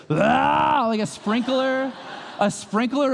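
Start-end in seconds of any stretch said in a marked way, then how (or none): 0.1-0.9 loud, high cry of alarm; dad reliving the kitchen crash, high startled yell. Retold or imitated. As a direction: yelp as the coffee sprays. Table crashing, coffee spraying everywhere.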